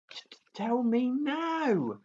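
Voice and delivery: high, slow stammering dragon voice; stuttering little dragon. A narrator's reading. Toothless demands the jokes, high slow stammer. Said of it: "said Toothless".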